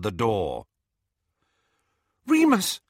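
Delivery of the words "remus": loud, much higher calling after him; crying out. Acted. Hermione calls after Lupin leaving, loud desperate cry. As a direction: call after the departing man. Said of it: "Hermione cried".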